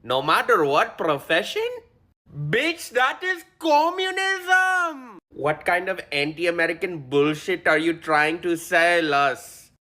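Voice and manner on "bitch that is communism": much higher, shouted outburst; furious screech; outraged shout. Acted. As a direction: blow up in indignant fury. Outraged rant at candidate.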